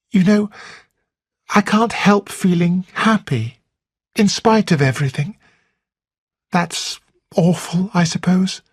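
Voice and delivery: bubbling over with guilty joy; happy Flora. A narrator's reading.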